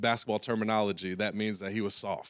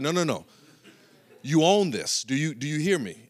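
higher, louder coach with a laugh; coach insisting; 5 semitones higher, 4 dB louder than usual. Retold or imitated. Coach insisting to Chris, higher and louder. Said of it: "He's like".

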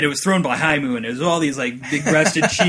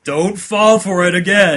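loud, slow, raised mock-stern warning; serious voice; 5 semitones higher, 7 dB louder, slower than usual. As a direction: imitate the sensible voice losing patience. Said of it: "your serious voice is like".

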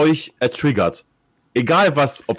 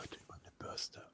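whisper; 30 dB quieter, slower than usual. Pretend. ASMR whisper parody.

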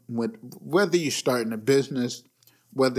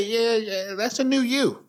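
sarcastic higher yeah yeah; mock approving, higher; 7 semitones higher than usual. Mocking someone's plastic surgery makeover, higher voice. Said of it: "I would say".

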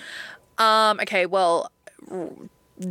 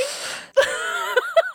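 high wailing laugh; disbelieving laugh-cry. Real, not acted. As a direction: break into laughing sobs. Laugh-cry at retold insult.

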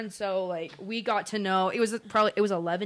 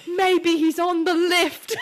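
high, loud, slow British impression; drawn-out mock English accent; put-on English accent; 8 semitones higher, 6 dB louder, much slower than usual. Imitating the family arguing over Ben, put-on English accent. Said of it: "I'm pretty good at accents".

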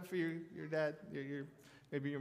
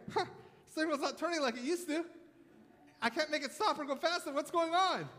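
put-on higher-pitched, puzzled kid starting to panic; mock panic; 7 semitones higher than usual. Boy at the wheel of a rolling car, mock panic. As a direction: imitate a bewildered child, voice raised in pitch.

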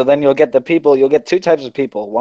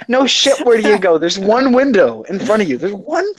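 higher, incredulous rant; exasperated; 7 semitones higher than usual. Retold or imitated. Worker on customer asking where to go, higher exasperated sarcasm. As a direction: spell out the obvious in exasperation. Mocks customer's stupid question.

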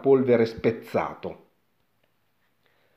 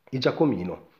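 ordinary delivery, nothing marked

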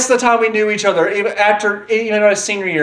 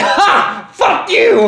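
gleeful taunt; 13 semitones higher, much slower than usual. Retold or imitated. Mock taunt at friend.

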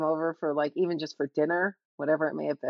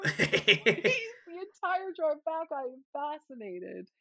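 ordinary delivery

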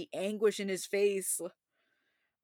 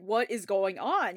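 ordinary delivery

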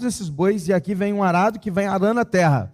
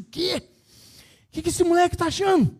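pai furioso; 11 semitones higher than usual. Retold or imitated. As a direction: explode at the report card like a furious father. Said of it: "ele ficou irado com aquilo". (pai furioso gritando, bem mais agudo)

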